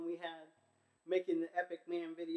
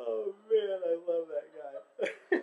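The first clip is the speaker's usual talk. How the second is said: louder, then slow, half laughing half crying; fond and overcome; a friend remembering him, laughing and near tears; laughing through tears; 3 semitones higher, 11 dB louder, slower than usual. Real, not acted.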